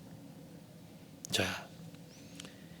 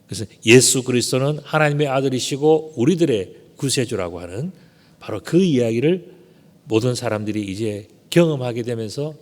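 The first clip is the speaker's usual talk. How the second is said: usual speaking voice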